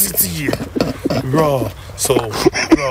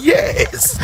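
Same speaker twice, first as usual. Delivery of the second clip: excited cheer. Real, not acted. Host cheering the listener calling her brothers, in a high excited whoop. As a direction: shout it like your team just scored.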